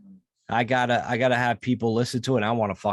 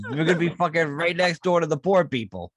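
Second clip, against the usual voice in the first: ordinary talk, nothing marked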